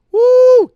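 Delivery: piercing high woo; mock shriek. Mocking the app profile with a shrieking woo. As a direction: whoop it up sky-high like a siren.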